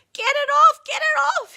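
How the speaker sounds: high, loud pleading shout; desperate yell. A narrator's reading. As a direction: scream it at the top of your voice, in pain. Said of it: "he yells".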